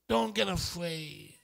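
ordinary talk, nothing marked

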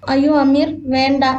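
usual speaking voice

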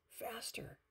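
high, light little squeal; high-pitched excited duck squeal. A narrator's reading. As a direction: squeal it high without volume. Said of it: "squealed Duck".

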